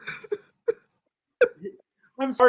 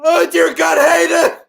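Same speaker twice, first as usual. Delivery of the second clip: higher, louder crying shout; anguished wail; 6 semitones higher, 7 dB louder, much slower than usual. Acted. Father wailing over his dead son's body. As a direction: howl his name in grief.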